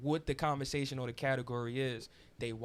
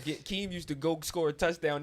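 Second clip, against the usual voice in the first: ordinary talk, nothing marked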